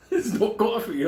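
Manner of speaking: high-pitched comic disbelief; mock dismay. Storyteller finds the kitchen out of coffee, mock dismay. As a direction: act appalled at an empty coffee pot.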